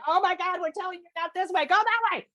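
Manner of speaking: a fed-up guide scolding; mock exasperated cosmic voice; exasperated universe. Universe voiced telling not this way.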